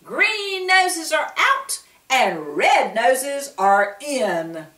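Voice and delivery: loud, slow salesman pitch; showman shout. A narrator's reading. Willie Withet selling red paint, loud slow barker. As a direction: sell it to the whole street. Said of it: "shouted Willie Withet".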